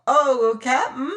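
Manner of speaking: high piping cry; piped. Acted. Smiling Sam pleading with the captain, high and piping. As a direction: pipe it up like a fat sailor. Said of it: "he piped".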